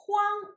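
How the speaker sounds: Huang held high and level; first tone. Shown as a demonstration. Teacher showing students Huang in the first tone. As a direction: say Huang on an even high pitch. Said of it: "pronounced in the first tone".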